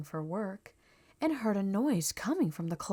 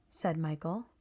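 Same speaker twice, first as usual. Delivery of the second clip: usual speaking voice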